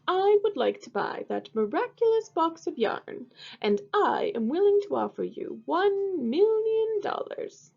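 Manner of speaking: haughty archduke. Acted. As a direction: play the pompous archduke looking down on her. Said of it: "said the archduke".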